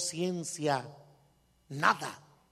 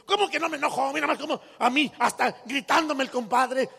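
indignant husband; 7 semitones higher, 4 dB louder than usual. Retold or imitated. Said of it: "dice la mujer".

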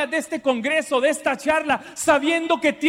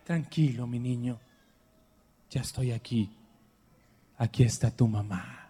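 much softer and lower, tender and hushed; mother's comfort; 12 semitones lower, 8 dB quieter than usual. Retold or imitated. Mother calming her frightened son at night, soft tender voice. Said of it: "me decía".

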